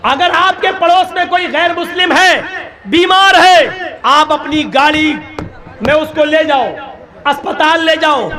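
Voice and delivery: ordinary talk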